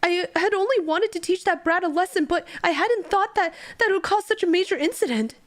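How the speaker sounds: high, strained confession; confessing villain. Acted.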